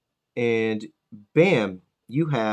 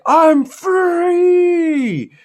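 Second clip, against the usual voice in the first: much higher, loud triumphant villain shout; villain breaking free; 8 dB louder than usual. Retold or imitated. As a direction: bellow it like a freed supervillain. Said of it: "And he's like".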